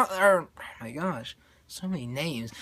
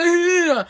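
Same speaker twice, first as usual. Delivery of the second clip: very high, loud comic shriek; hammed-up victim's wail; mock scream; 11 semitones higher, 8 dB louder than usual. Jerk being beaten up, mock scream.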